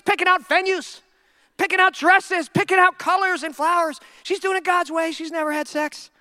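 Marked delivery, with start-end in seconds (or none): none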